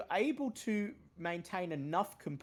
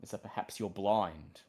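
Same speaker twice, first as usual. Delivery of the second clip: low, slow, ominous storyteller voice; dramatic narration; 3 semitones lower, slower than usual. A narrator's reading. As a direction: draw the players into the darkness. GM narrates the death scene.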